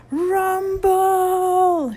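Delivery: long, high-pitched rallying holler; drawn-out battle cry at full volume; deafening shout. A narrator's reading. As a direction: bellow it across the whole camp. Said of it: "shouted a deafening voice".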